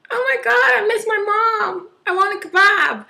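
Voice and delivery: high, loud, whiny wail; mock whiny drunk girl. Mocking the crying drunk girl in the toilet with a high whiny wail. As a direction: ham up a homesick drunk fresher. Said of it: "And they're just like".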